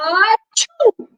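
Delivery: high, built-up storybook "achoo"; exaggerated sneeze with a wind-up; big mock sneeze. A narrator's reading. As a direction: act out an allergic sneeze. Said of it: "sneezed, he just fell down".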